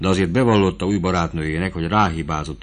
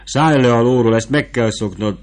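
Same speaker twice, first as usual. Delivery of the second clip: higher, louder command to dismount; vivandière ordering the boy down; sharp order; 5 semitones higher, 6 dB louder than usual. Acted. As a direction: snap him off the horse. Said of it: "szólt rá a markotányos nő".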